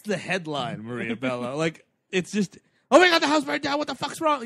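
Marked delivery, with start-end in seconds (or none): 2.9-4.4 higher and louder, a panicked outburst; frantic wife. Retold or imitated. Wife phoning her husband, mock frantic.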